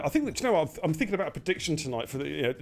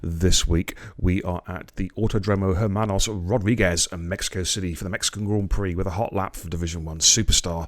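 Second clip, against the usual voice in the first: much lower, louder announcer voice; announcer intro; 7 semitones lower, 6 dB louder than usual. Acted. Host opening his hot lap special for viewers, booming announcer voice. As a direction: boom it like a TV show opener.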